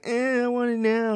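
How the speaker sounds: high-pitched mock child tantrum; whining little girl. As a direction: whine like an impatient kid. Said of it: "And she's like".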